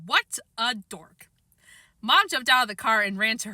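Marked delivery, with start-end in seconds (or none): 0.1-1.3 scornful aside. Acted.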